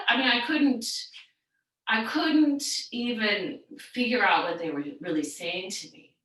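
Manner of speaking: normal talking voice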